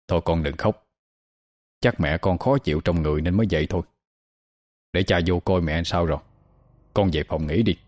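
soft, low fatherly comforting; soothing. A narrator's reading. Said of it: "thì dịu giọng dỗ dành". (dịu giọng dỗ dành)

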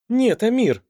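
high, bright laughing protest; playful protest. A narrator's reading. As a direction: squeal a playful no.

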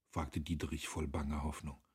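plain reading voice

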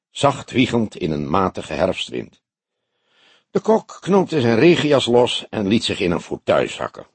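ordinary delivery

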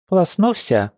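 put-on high, sly child's question; a cheeky toddler checking; little girl voice. A narrator's reading.

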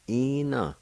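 "ina" with a long, high first vowel; pronunciation demo. Shown as a demonstration. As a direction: stretch the start of "ina" high, then drop. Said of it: "let's pronounce these words one more time".

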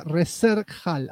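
Reserhal sounded out slowly, as spelled; slow, deliberate Spanish reading of Reserhal; literal mispronunciation. Shown as a demonstration. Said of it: "así, Reser con H".

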